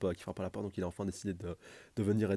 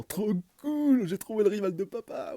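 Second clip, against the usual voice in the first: much higher and louder, bursting with excitement; thrilled kid; 13 semitones higher, 8 dB louder, slower than usual. Acted. Boruto overjoyed at finding his dad's rival, high excited squeal. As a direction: squeal it like a kid who just met his hero.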